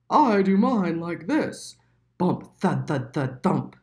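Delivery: thumping out each sound; horse voice. A narrator's reading. The horse showing Little Mole its droppings, loud and heavy.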